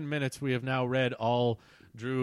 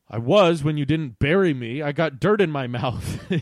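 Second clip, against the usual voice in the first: loud complaint breaking into a laugh; indignant mock yell; 3 semitones higher, 8 dB louder than usual. A narrator's reading. Ian scolding friends for not burying him, mock yelling. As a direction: yell the grievance, half amused. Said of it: "yelled Ian".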